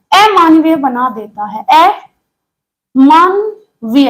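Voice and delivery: अमानवीय stretched slowly, word by word; a teacher spelling out a term; slow dictation. Shown as a demonstration.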